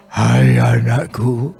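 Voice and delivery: slow, low voice of God calling. Retold or imitated. God calling to the praying preacher, slow and low. God's voice heard in prayer.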